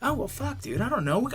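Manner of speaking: high, startled and baffled; taken aback. Retold or imitated. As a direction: pitch up in surprise. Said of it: "I'm like".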